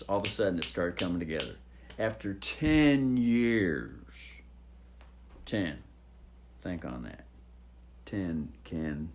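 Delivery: soft, low, gentle demo; calm down. Shown as a demonstration. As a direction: drop right down and speak gently. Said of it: "just to calm down".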